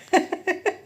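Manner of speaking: sudden high laugh; high burst of laughter. Real, not acted. Mother laughing at daughter's teasing about confidence, high laugh.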